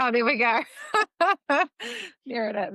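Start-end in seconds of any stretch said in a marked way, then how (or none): 0.0-0.6 laughing. Real, not acted. Host laughs at the coincidence. 0.7-2.2 a real delighted laugh that jumps up high; high burst of laughter. Real, not acted. Host laughing when she hears her guest's parents are from Ireland.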